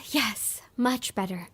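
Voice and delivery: low, soft, relieved voice; subdued and settled; calmed down. Acted. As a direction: say it softly on the tail of a long breath. Calmed after breathing exercise.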